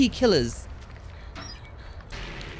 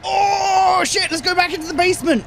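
high loud frightened cry; panicked shriek; 12 semitones higher, 6 dB louder than usual. Real, not acted. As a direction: shriek while running away. Panicked retreat from killer.

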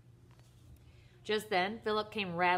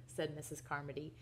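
ordinary delivery, nothing marked

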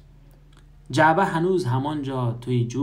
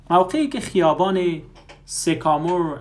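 first take, higher and louder; 6 semitones higher, 5 dB louder than usual. Shown as a demonstration. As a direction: push the phrase out, higher and louder. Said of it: "re-read correction".

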